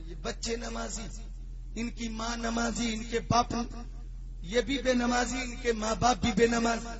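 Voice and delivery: ordinary talk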